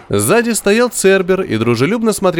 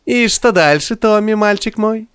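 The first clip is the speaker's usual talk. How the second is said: mocking; 9 semitones higher than usual. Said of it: "сказал он с издёвкой".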